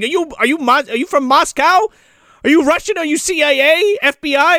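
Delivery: high, insistent suspicious questions; mock interrogation. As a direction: fire questions like a paranoid captor. Militia leader interrogates Santa, retold.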